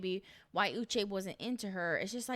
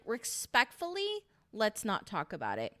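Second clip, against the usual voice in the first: pointed politeness. Retold or imitated. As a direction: stretch it out as a pointed polite brush-off. Retells a polite refusal to gossip.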